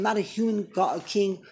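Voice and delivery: usual speaking voice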